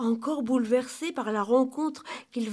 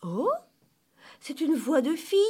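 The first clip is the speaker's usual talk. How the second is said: high, amused surprise; tickled by the discovery; 6 semitones higher, 4 dB louder than usual. A narrator's reading. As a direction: exclaim it with lively amusement. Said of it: "s'amusa Émilie".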